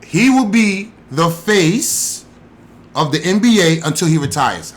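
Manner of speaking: stretched out and pressed, every word weighted; slow emphatic declaration. Real, not acted. Host stamping his pick, drawn out and emphatic. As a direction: lay it down slowly like a verdict. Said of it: "I'm gonna put the stamp on it right now".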